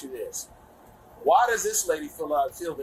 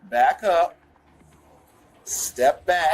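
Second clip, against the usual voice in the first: firm command; 5 dB louder, much slower than usual. Real, not acted. Officer orders woman to step back.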